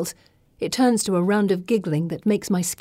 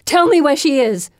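loud, high furious shout; angry demand; 8 semitones higher, 8 dB louder than usual. A narrator's reading. Gemma demands Pippa reveal Wendy, shouting. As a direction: slam the demand home.

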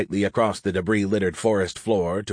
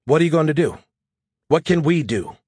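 higher, louder, pressing demand; 6 semitones higher, 4 dB louder than usual. Acted. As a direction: push him hard for an answer. Adams glares, demands answers.

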